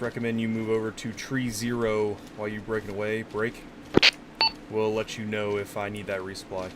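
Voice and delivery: ordinary talk, nothing marked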